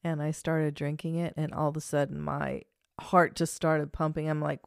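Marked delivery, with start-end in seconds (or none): none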